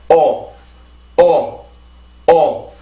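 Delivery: 어 with jaw slightly dropped and cheeks pulled in; cheeks-drawn-in vowel. Shown as a demonstration. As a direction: model 어 alone, falling, with pauses. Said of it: "의식적으로 볼을 안으로 넣어야 돼요".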